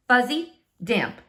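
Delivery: ordinary delivery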